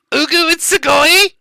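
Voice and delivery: very high-pitched squeal of a catchphrase; cutesy catchphrase voice. Shown as a demonstration. Streamer performing an old clip's catchphrase for a fan, high squeaky voice. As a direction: squeak it out far above your own voice. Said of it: "There's a clip of you saying".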